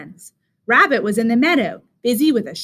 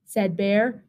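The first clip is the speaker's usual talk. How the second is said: ordinary delivery, nothing marked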